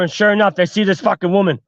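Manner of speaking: ordinary delivery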